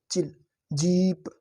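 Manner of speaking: चिल with a short i, जीप with a long ee, slowly; slow vowel contrast. Shown as a demonstration. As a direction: drill चिल and जीप for the class. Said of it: "इसमें लंबी भारी, इसमें हल्की".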